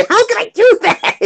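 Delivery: high-pitched, incredulous laughing squeal; laughing protest. Real, not acted. Laughing at an impossible request.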